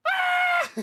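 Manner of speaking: loud, very high shriek; excited, piercing squeal; hammed-up cartoon scream; fangirl scream.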